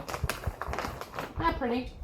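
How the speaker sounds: breathless, hushed admiration; almost whisper it, admiring; a soft, airy marvel at the yarn; hushed awe. Real, not acted. Showing off pretty yarn.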